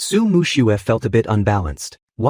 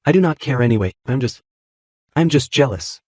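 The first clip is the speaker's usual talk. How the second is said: choked up. A narrator's reading. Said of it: "her voice choked".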